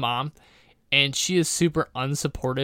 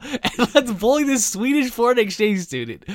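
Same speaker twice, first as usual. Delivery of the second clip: higher and louder, laughing through the taunt; laughing mock bully; 6 semitones higher, 8 dB louder than usual. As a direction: egg the class on through laughter. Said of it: "everyone would be saying".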